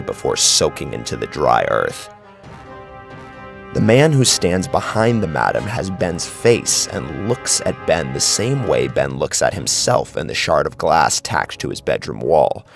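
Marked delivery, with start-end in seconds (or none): none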